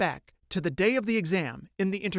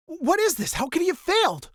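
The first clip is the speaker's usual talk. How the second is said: put-on high worried girl's thought; worried inner voice; 12 semitones higher than usual. Riho thinking to herself, higher worried voice. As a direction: think it in a higher anxious girl voice.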